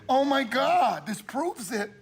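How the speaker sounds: ordinary delivery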